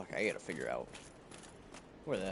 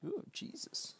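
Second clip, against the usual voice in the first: breathy whisper of fright; startled whisper; 8 dB quieter, slower than usual. Real, not acted. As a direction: gasp it out under your breath. Startled by something in game.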